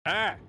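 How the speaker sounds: sharp high yelp; yelp of pain. Retold or imitated. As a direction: yelp as if it hurts. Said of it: "it's like".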